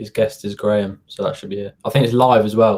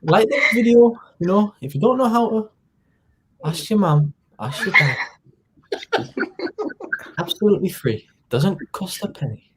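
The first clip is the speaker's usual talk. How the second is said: put-on Sunderland accent; 7 semitones higher than usual. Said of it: "back to [name] in his Northeast, uh, Sunderland accent".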